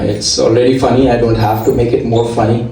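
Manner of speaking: ordinary delivery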